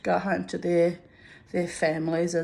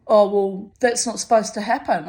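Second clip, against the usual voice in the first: louder, higher incredulous question; loud demanding sergeant; 4 semitones higher, 7 dB louder than usual. Retold or imitated. Sergeant grilling her about the fall, loud and incredulous. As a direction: bark it at her.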